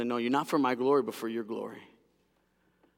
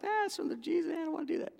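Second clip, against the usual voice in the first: high-pitched mock complainer, softer; dismissive moviegoer; 5 dB quieter, faster than usual. Preacher mimics people rejecting a Bible film, high dismissive voice. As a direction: whine a high, quiet brush-off.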